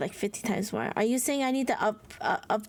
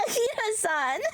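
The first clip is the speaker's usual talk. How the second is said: squealing cry; 9 semitones higher than usual. Real, not acted. Overjoyed at a card pull.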